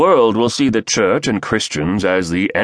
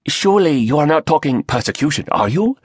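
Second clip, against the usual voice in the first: higher, fast, protesting Chinese voice; protesting Chinese accent; 9 semitones higher, faster than usual. Acted. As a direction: object sharply with a Chinese accent. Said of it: "a Chinese voice protested".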